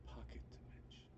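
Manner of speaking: barely audible whispered echo; speaking too low. Acted. Game master's character echoes the player's words, whispered too low. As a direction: murmur it far under your breath. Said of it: "I was speaking too low".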